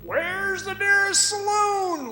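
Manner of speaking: slow, high mock question from a visitor; miner's joke voice. Old miner retelling a visitor asking for a saloon. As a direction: pitch the question up like a greenhorn asking. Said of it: "one fellow would say".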